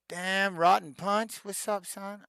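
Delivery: playful shout-out to a fan; hyped greeting. Real, not acted. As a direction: call the name out like you're greeting a buddy.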